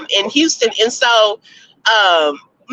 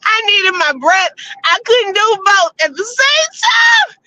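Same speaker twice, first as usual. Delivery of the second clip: higher, laughing through it; 9 semitones higher than usual. Real, not acted. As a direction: crack up while telling. Laughs telling band story.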